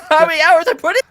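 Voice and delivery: laughing through the words, high and loud. Real, not acted. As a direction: giggle at how much he played. Laughing at own playtime.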